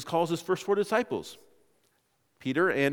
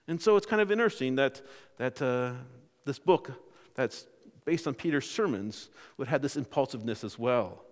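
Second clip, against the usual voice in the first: normal talking voice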